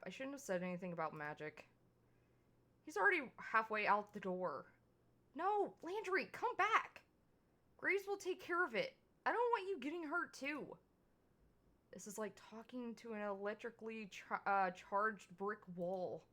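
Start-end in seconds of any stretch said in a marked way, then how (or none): 5.3-10.6 high, pleading call after him; desperate plea. Acted. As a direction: beg him to come back, voice pitched way up. Begging Landry not to leave.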